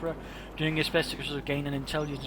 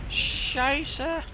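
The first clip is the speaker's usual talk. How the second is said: German soldier curse; 11 semitones higher than usual. Acted. Caster voices German soldier cursing.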